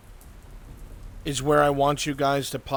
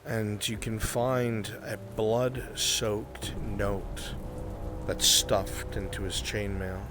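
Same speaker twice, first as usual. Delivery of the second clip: low, measured, grave scene-setting; game master narration; 5 semitones lower, 7 dB quieter than usual. Acted. Game master telling players of a blood-soaked note, low ominous narration. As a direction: narrate low and steady, letting pauses hang.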